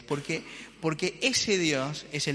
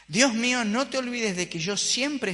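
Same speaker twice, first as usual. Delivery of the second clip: clamor; 8 semitones higher, 4 dB louder than usual. Acted. Ezequías clama a Dios.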